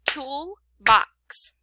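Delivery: "Toolbox" split into tool and box; two separate beats on "Toolbox" with a gap between; clapped-out syllables. Shown as a demonstration. Teacher counting syllables for pupils, "Toolbox" in two spaced beats. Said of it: "we can figure out".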